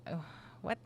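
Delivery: ordinary talk, nothing marked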